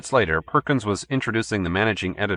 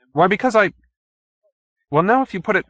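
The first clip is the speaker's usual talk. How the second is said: higher, halting and caught out; stumped reporter groping for words, pitched up; flustered stammer; 11 semitones higher, 5 dB louder, faster than usual. A narrator's reading. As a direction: stumble for an answer. Perkins flustered, stumbling.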